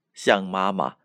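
plain reading voice

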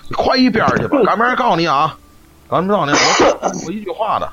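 put-on high-pitched scolding old woman; mock quarrel. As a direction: scold like a cranky granny in the hutong. Retold old woman cursing neighbor. (高声骂街的老太太)

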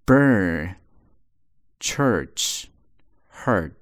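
burn church hurt with a strong American r; er sound in burn, church, hurt with the tongue curled; curled r vowel. Shown as a demonstration. Teacher models the American er sound. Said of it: "所以在卷舌头啊".

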